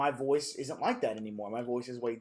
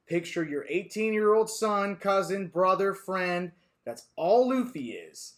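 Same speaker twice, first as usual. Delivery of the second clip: emphatic; 5 semitones higher, 5 dB louder, slower than usual. Real, not acted. Urging listeners to picture Luffy.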